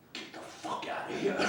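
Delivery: retold hushed dismissal. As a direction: push the boss's dismissal out under the breath. Retells the boss's final response.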